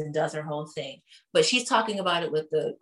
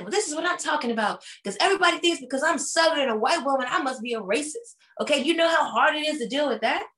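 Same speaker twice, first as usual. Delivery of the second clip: higher, faster hammed-up Southern whine; whiny Sutton impression; 7 semitones higher than usual. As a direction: play her as a put-upon Southern woman. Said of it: "she's like".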